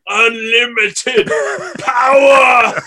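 slow, loud, high-pitched roar of power; hammed-up villain cry. Co-host caps the electricity bit, hammed-up villain shout. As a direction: stretch it into a huge mock-evil shout.

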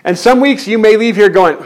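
ordinary talk

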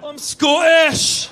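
loud, slow, high Scottish roar; Scottish accent. Retold or imitated. Scot insisting he is not Irish, shouted Scottish accent. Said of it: "By the way, Scottish voice".

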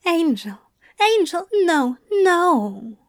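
gently urgent Crowley, calming the angel; Crowley comforting. A narrator's reading. Crowley's voice in narration.

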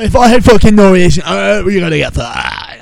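very loud, high, badly faked Norwegian accent; attempted Norwegian accent. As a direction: bellow a hammed-up Norwegian accent. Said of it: "I can't do an accent".

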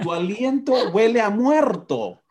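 high, slow, hammed-up complaint; disgusted outcry. Husband imitates wife reacting to his breath, hammed-up disgust. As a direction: draw it out high in mock horror.

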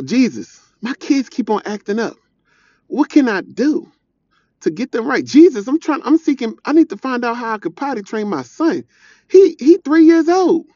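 high, loud, pleading cry of a worn-out parent; desperate parent praying. Acted. A parent praying to Jesus over a toddler, pleading high and loud.

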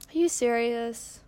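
slow, incredulous question; exasperated disbelief. Real, not acted. Podcaster to the noisy tractor outside, exasperated disbelief. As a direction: drag it out in real exasperation.

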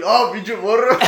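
put-on laughing gruff curse at the kid; a drunk man cursing the boy who hit him, loud and laughing; mock angry drunk. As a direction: spit it out as the drunk guy, laughing.

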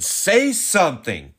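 high, loud, drawn-out urging; urgent inner voice. Retold or imitated. Husband retelling the inner urge to speak up, urgent and loud. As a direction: urge yourself on loudly, stretching the words. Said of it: "something inside of me said".

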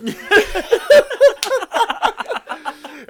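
high, loud burst of laughter; a big hearty cackle. Real, not acted. Laughing at own bloody story.

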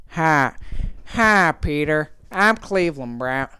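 slow, drawn-out cartoon character impression; Cleveland Brown. Brother recreating a silly voice message for his sister, character impression. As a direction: stretch the vowels in an amateur character voice. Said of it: "this impression of me doing Cleveland".

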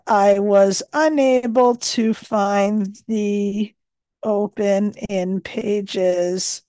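slow, careful dictation pace; dictating. Acted. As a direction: spell it out word by word for the phone. Dictating a note to the phone.

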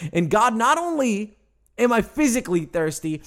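usual speaking voice

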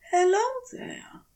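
high, hushed polite hello; soft greeting. Acted. Aziraphale greeting the winged creature, soft and polite. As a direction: greet it as softly as you can. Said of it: "as soft as Aziraphale can manage".

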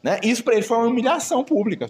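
normal talking voice

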